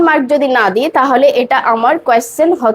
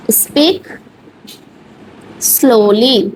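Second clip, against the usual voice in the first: teacher demonstrating how slow speech sounds; word by word; much slower than usual. Shown as a demonstration. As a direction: speak rising, a long pause, then slowly falling. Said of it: "আস্তে আস্তে বলুন".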